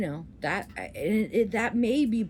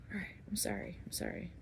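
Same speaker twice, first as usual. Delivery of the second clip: hushed, near-whispered apology; reeling it in; 12 dB quieter, faster than usual. Real, not acted. Apologizing after an outburst, hushed and reeled in. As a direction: drop right down and apologize under your breath. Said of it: "I'm trying to reel it in".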